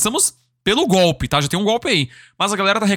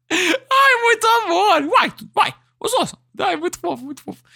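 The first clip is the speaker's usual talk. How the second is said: fond laughter; 11 semitones higher than usual. Real, not acted. Streamer delighted by cute Mineiro talk, laughing fondly. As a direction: gush while laughing, much higher pitched.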